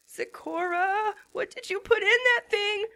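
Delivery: mild rising panic; panicked. A narrator's reading. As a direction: cry out in alarm. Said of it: "I panicked".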